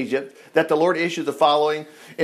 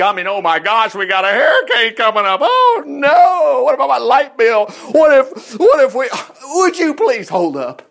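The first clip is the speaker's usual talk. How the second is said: mock panicked worrier, higher and louder; 5 semitones higher, 5 dB louder than usual. Preacher imitating a fretting believer, mock panic. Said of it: "and say".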